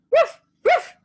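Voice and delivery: high, loud yapping woofs; barking. A narrator's reading. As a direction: bark like a little dog. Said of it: "barked Little Scarecrow".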